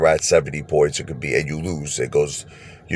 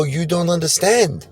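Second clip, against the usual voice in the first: high, slow, drawn-out put-on voice; casino guy's voice; 14 semitones higher, 4 dB louder, slower than usual. Casino man tells the gambler he's doing well, high and slow. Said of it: "He go".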